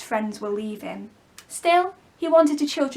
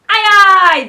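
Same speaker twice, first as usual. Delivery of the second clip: pirates agreeing; 9 semitones higher, 15 dB louder than usual. Acted. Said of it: "the pirates said".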